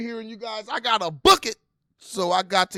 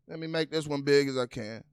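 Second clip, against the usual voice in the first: ordinary delivery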